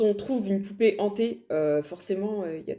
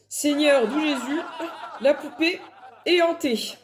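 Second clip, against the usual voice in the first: laughing through it, voice pitched up; laughing exclamation; 4 semitones higher than usual. Real, not acted. Telling viewers the doll is haunted, laughing. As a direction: laugh out the punchline in disbelief.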